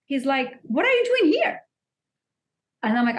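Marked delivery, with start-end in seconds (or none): 0.6-1.6 much higher, curious question; curious man. Retold or imitated. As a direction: voice the stranger's surprise, pitched up. Man's question, voiced in story.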